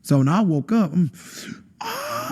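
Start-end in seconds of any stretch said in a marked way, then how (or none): 1.8-2.3 high, drawn-out "ah" of a retold scream; mock scream. Waking from hell in a hotel, mock scream. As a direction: re-enact waking up screaming, not full force. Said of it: "I'm screaming at a hotel".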